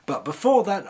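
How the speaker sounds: normal talking voice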